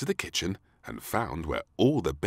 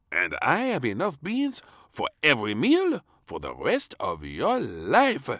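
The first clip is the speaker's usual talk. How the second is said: higher, louder funny chef; 8 semitones higher, 6 dB louder than usual. Acted. Chef waving his tray at the princess, proud. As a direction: boast like a proud cook. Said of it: "he said".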